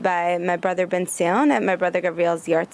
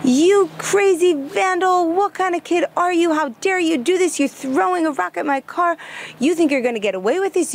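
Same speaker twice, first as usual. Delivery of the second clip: angry yelling; 8 semitones higher than usual. Retold or imitated. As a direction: play the car owner yelling at the kid caught by his car. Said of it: "he started yelling at me".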